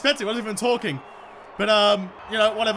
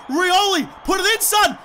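high, raised-voice call to the player; excited urging; 8 semitones higher, 4 dB louder than usual. Real, not acted. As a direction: egg the player on eagerly.

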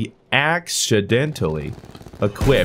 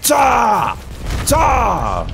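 high, loud shouted gun noises; mock gunshot shouts; 10 semitones higher, 3 dB louder than usual. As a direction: bark out shots like a gun. Gunfire sound effect.

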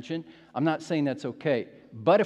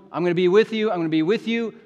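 loud, higher, repeated promise; insistent assurance; 5 semitones higher, 10 dB louder, faster than usual. Acted. The Lord keeps coming to Moses, loud insistence. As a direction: press it home loudly, twice.

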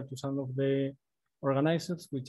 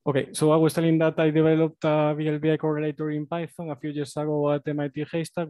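raised, louder presenting voice; speaking louder; 6 dB louder than usual. Real, not acted. Presenter restarting after volume trouble, speaking louder. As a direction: project firmly into the microphone. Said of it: "I'll try to speak louder".

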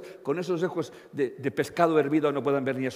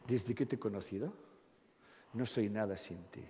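soft, hushed lover's vow with a laugh; clingy devotion; 6 semitones lower, 13 dB quieter than usual. Retold or imitated. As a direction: coo it like a mushy sweetheart.

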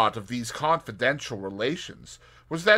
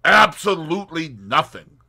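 cheery; 7 semitones higher, 12 dB louder than usual. Acted. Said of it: "said Tommy cheerily".

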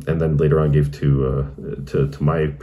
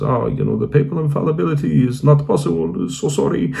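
imitated Romanian priest, higher pitched; Romanian accent; 10 semitones higher than usual. Romanian priest telling a convert infallibility is not possible, imitated Romanian accent. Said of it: "in his wonderful accent".

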